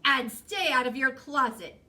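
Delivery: cross mama's final command; stern motherly warning. A narrator's reading. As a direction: lay down one last rule firmly. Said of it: "she added".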